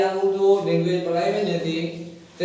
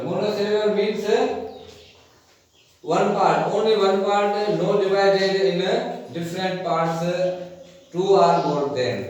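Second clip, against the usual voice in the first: slow explaining; much slower than usual. Shown as a demonstration. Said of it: "teacher explains the English meaning".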